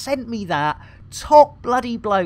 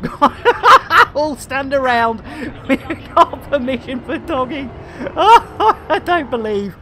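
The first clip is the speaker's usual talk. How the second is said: high, loud words broken by real laughter; giggling through the line; laughing; 5 semitones higher, 4 dB louder than usual. Real, not acted. Streamer cracking up at his own innuendo, laughing.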